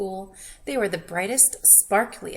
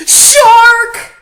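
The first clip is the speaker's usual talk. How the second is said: very high, very loud cry; scared shout; 13 semitones higher, 22 dB louder than usual. Pretend. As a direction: scream in fright. Reader reveals the shark.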